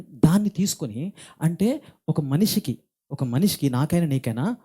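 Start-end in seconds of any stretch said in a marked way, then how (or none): none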